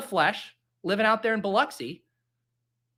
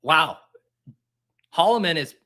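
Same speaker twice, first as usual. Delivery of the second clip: ordinary delivery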